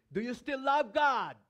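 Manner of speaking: high, loud, pressing question to the congregation; emphatic loud question. Real, not acted. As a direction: hammer the question home, not angry but insistent. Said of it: "I'm just emphasizing that word".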